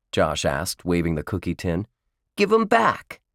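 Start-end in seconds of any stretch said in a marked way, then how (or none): 2.4-3.2 put-on high, insistent boyish order; indignant demand. A narrator's reading. Josh orders twins to return cookies, indignant demand. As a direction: insist on getting them back.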